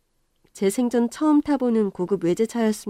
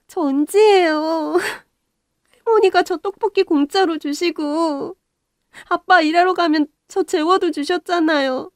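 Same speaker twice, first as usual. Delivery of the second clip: eager reminder; 8 semitones higher, 5 dB louder than usual. Acted. Grown girl reminds grandmother eagerly.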